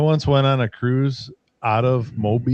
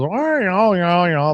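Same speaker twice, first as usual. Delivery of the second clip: higher wah-yaw noise like the Peanuts teacher; imitated wah-yaw drone; 4 semitones higher, 3 dB louder, slower than usual. Mimicking what Alabama talk sounded like on the cruise, wah-yaw drone. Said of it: "That's all I heard was".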